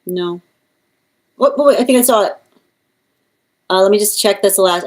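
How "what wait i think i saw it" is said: louder, higher, abrupt realisation; sudden surprise. Real, not acted. Reader spotting the giveaway number in chat, surprised. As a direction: catch it mid-scroll, startled.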